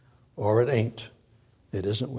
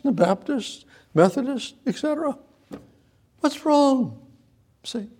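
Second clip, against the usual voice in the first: higher, mock-innocent what's wrong with them; mocking neutral believer; 7 semitones higher than usual. Preacher mimicking lukewarm churchgoers, mock-innocent and higher. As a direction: play the shrugging believer, pitched up.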